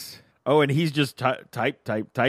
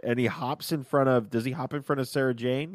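ordinary talk

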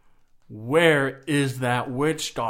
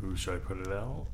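quiet; 5 semitones lower, 10 dB quieter, faster than usual. Real, not acted. Player quietly wonders.

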